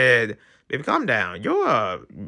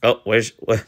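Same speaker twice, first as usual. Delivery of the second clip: ordinary talk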